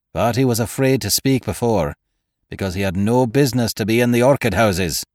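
a hint of a testy old master; stern old master. A narrator's reading. Saunderson voiced by narrator.

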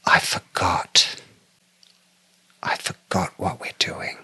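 breathless whisper of the friend; hushed, stunned whisper. Retold or imitated. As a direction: whisper it, dazed and slow. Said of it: "she said".

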